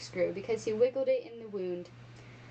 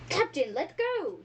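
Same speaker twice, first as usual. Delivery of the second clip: high, drawn-out protest; slow, high-pitched plea; pained cry; 7 semitones higher, 3 dB louder than usual. A narrator's reading. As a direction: cry out as if writhing in a grip. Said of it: "he cried, writhing".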